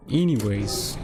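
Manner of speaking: stretched-out "Anyways" to move things along. Real, not acted. Repeats word, stretching it playfully.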